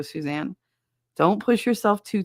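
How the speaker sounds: ordinary talk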